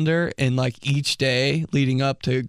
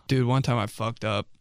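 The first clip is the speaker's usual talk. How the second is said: ordinary talk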